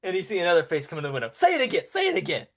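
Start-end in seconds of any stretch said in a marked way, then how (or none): 1.3-2.5 excited guard. Acted. Guards urge him to repeat.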